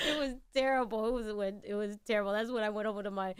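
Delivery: normal talking voice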